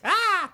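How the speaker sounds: mock scream. Imagining a mugger's knife, a hammed-up scream. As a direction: throw out a hammed-up yelp. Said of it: "I'd be like".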